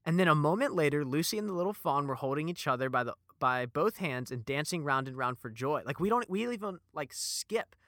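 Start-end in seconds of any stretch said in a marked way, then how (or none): none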